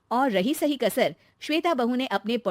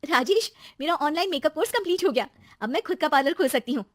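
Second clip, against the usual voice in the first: high, eager announcement; excited young wife; 7 semitones higher than usual. Acted. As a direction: tell husband the news with delight. Narrator voices Shweta. (उत्साहित बहू)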